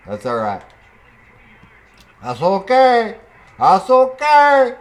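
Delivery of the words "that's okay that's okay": loud, very high, stretched words; high-pitched mock reassurance. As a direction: squeal that all is fine, hammed-up. Mock consoling himself after blunder.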